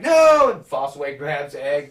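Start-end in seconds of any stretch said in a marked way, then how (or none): 0.0-0.5 high, loud desperate cry of no; a boy's panicked shout of no; frantic yell. Retold or imitated. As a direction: scream it to stop the attack. Egg cries out to stop Dunk.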